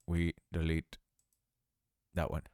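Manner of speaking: a flat machine-like robot sound; robotic glitch. Real, not acted. Narrating an animation tutorial, voice glitches robotic. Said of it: "I sound like a robot there".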